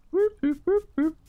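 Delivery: high whooping siren imitation; mock siren. Window cleaner playing siren. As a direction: whoop like a little alarm going off.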